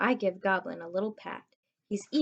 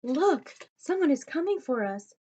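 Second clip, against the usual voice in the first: higher and louder, urgent warning; alarmed; 6 semitones higher, 4 dB louder than usual. Acted. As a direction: point and warn in alarm. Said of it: "says George".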